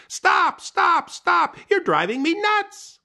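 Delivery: very high, loud frantic yell. Acted. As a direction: scream out the hole to stop. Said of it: "He yelled out the hole".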